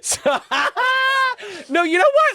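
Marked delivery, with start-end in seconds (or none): 0.2-2.3 much higher, laughing outburst; laughing excitedly. Real, not acted. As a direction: squeal it through real laughter. Bursting out laughing, defiant joke.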